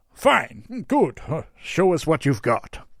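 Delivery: high, loud, flustered Santa; Santa act. A narrator's reading. Santa tells Yule to show the models, flustered. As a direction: blurt it in a theatrical Santa voice. Santa responds after a pause.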